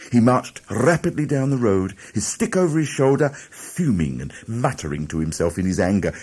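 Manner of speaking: ordinary delivery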